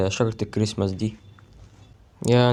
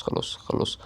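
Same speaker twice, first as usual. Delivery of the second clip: whisper; 9 dB quieter, slower than usual. Real, not acted. Giving up on the match.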